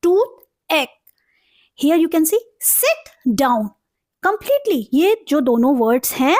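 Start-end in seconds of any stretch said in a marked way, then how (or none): 0.0-0.9 "टूथ एक" pulled apart with a pause, both halves strong; two separate stressed beats on "टूथ एक"; both syllables stressed. Shown as a demonstration. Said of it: "how would we pronounce". 2.6-3.7 "sit down" said slowly as two separate strong beats; slow, evenly weighted "sit down"; both words stressed. Shown as a demonstration. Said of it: "अपने आप में strong sound".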